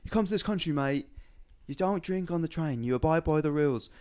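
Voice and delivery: put-on high, lightly posh drunk rant; posh drunk banker. Imitating a posh banker scolding on the bus. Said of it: "he's a posh fucking wealthy".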